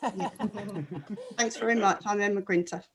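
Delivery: normal talking voice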